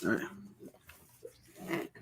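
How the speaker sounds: breathy whispered all right under the breath; whispered aside. Real, not acted. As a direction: whisper it to yourself before starting. Muttered aside before reading.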